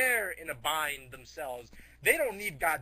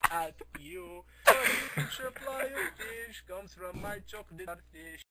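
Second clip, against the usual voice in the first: slow, drawn-out villain voice; slow monster boast; slower than usual. Retold or imitated. The Great Mighty Pooh threatens Japan slowly. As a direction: drag each word out like a monster. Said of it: "He's gonna be like".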